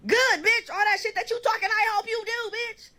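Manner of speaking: high, punchy retold challenge; defiant comeback. Answering the rival who wants to fight, defiant comeback.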